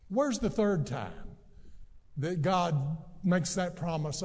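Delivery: ordinary talk, nothing marked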